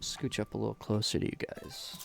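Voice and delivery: soft ASMR whisper. Real, not acted. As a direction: whisper close to the mic. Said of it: "Oh, A S M R, right?".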